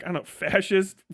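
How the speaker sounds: ordinary delivery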